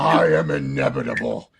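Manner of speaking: a hammed-up imitation of a villain's catchphrase; Thanos. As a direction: drop way down and intone it like a doomed tyrant. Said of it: "Can you imagine Thanos?".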